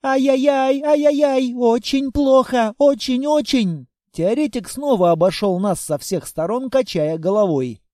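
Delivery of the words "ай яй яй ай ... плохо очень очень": higher tut-tutting lament; fretting fussy theorist. A narrator's reading. Корнелиус бегает вокруг и причитает, охая. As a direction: fuss and cluck like a worried old hen.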